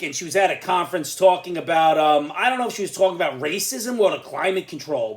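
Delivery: usual speaking voice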